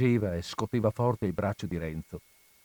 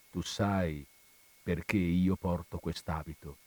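softer, grave and measured; low and slow; 4 semitones lower, 6 dB quieter than usual. Acted. Friar confesses his past to Renzo: softer grave voice. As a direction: let the voice drop, dark and slow. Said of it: "con voce cupa e lenta".

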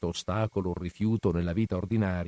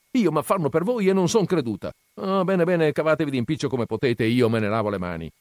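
higher and louder, stung and huffy; offended mother; 7 semitones higher, 5 dB louder than usual. A narrator's reading. Agnese offended that Renzo doubts her, indignant outburst. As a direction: wash your hands of them in a mock huff.

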